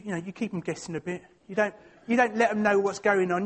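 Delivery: ordinary talk, nothing marked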